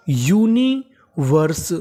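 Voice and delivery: Universe very slowly, split as uni - verse; syllable by syllable. Shown as a demonstration. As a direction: draw out universe in separate parts for learners. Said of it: "तो meaning बनी". (Universe को तोड़कर बहुत धीरे)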